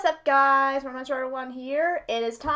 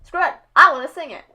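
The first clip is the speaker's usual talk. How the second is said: retold exclamation; 3 semitones higher, 9 dB louder, faster than usual. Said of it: "I was like".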